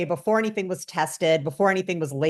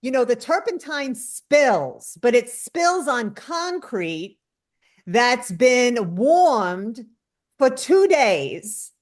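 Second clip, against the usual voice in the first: higher, slow, savoring connoisseur voice; Kevin Jodrey; 6 semitones higher, slower than usual. Retold or imitated. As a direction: relish each word like a fussy expert. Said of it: "but he'll be like".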